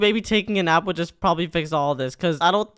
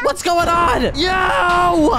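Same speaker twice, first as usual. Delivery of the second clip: high-pitched startled yell; panicked yell; 9 semitones higher, 3 dB louder, much slower than usual. Acted. Suddenly not knowing what's going on, panicked yell. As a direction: cry out in alarm.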